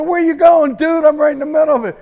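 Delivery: high, loud mock outrage; indignant call-out. Performer to a man walking out mid-show, indignant. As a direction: call after him in retold indignation.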